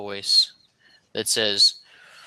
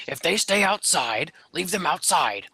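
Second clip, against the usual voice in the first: deeper goblin voice; 6 semitones higher than usual. Acted. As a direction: scold the underling like a goblin chief. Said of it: "deeper goblin voice".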